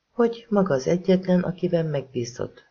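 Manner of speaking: plain reading voice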